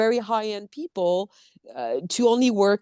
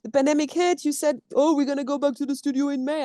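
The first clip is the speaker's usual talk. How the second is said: indignant retort; 6 semitones higher than usual. Retold or imitated. Contradicting partner who claimed her idea, indignant. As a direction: act out an argument, correcting the other. Acted argument with former partner.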